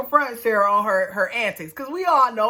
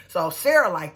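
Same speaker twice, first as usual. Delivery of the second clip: usual speaking voice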